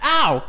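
sharp yelp of pain; just got slapped. Real, not acted. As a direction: cry out from a sudden slap. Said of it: "You slapped me".

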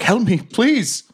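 high, drawn-out cry for help; slow, high-pitched mock distress; acted plea for help. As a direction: plead as someone turning to stone. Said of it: "And she's like".

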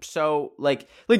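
ordinary talk, nothing marked

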